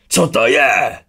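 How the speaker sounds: high, loud roar of shock; roared question. A narrator's reading. Urn bellows at the laughing apparition, loud shout. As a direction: bellow it out in alarm. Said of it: "Zařval Urn".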